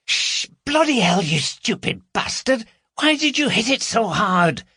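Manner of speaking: high, sharp alarmed scolding; panicky scolding woman. Acted. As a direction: hiss at him in a panic. Carol scolds Gary in panic.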